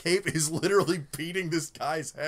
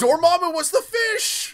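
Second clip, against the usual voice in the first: high, loud excited cry; 11 dB louder than usual. Real, not acted. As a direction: blurt the punchline out. Excited gag reveal.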